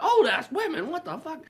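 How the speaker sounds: very high, offended squeal; mock indignant echo. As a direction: throw the insult back in high outrage. Women echo the insult, outraged.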